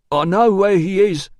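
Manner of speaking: high, loud announcement; Stickus announcing. A narrator's reading. Newcomer bursting in with news, loudly announcing. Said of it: "burst open and Stickus announced".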